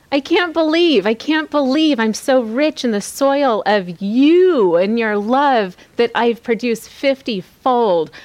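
amazed prayer. Acted. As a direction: marvel out loud at your riches. Acted prayer of amazed gratitude.

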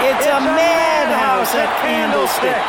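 slow, high, shouted Bronx broadcaster call; Bronx-accented commentator shouting over the crowd. Retold or imitated. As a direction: belt it out like an old Bronx sportscaster. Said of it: "by saying in his Bronx accent".

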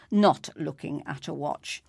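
ordinary delivery, nothing marked

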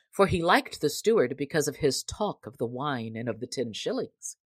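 plain reading voice

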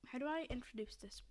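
whispered aside; whispered aside while wondering how to introduce it. Real, not acted. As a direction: whisper it to yourself.

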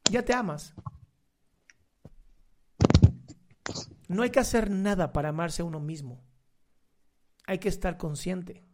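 low, soft meditation voice; calm, gentle affirmation; guided meditation. Acted. Closes the breathing meditation.

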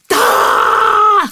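high-pitched piercing mock scream; mimicked meltdown shriek; imitated child's scream. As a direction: scream out one long wordless cry. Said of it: "berömda skrik som han kan göra".

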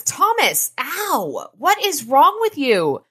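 high, loud and drawn out; mock yell. [name] yelling at his brother on the stairs, loud and high. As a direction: yell at your brother, exasperated.

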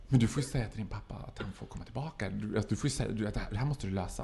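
low, hushed, urgent pleading; dämpad vädjan. Acted. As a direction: plead quickly under your breath. Acts out urgent plea while hiding. (lågmäld, skyndsam vädjan)

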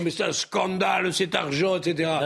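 put-on outraged protest in a Parisian accent; parisian french accent. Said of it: "avec l'accent parisien". (accent parisien indigné)

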